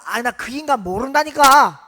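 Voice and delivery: loud, exasperated outburst; angry denial. Acted. Peter's third denial to the servant, loud and angry. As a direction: shout him off in exasperation.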